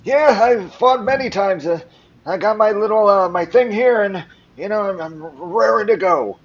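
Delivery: put-on high, loud old-timer boasting; talkative old man. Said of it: "say anything to this old man".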